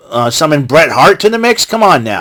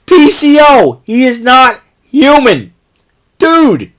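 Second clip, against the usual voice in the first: much higher, louder hyped-up cry; excited warning; 8 semitones higher, 3 dB louder than usual. Retold or imitated. Fan warning Maclin about PCO, hyped-up and loud. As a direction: shout it at him like a fan who can't believe it. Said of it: "I'm like".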